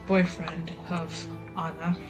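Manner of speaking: ordinary delivery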